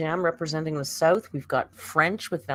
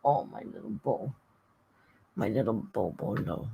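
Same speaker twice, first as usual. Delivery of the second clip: tender cooing; 7 semitones lower, 5 dB quieter than usual. Real, not acted. Cooing to the cat.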